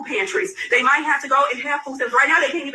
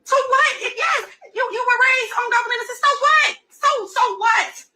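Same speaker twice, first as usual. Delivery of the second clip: emphatic so what; 11 semitones higher than usual. Real, not acted. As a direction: push the words out with fired-up conviction. Urging friend to drop shame.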